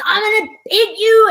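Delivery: high-pitched mock angry threat; mad father. Imitating dad threatening his ten-year-old, mock angry. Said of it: "he was mad at me".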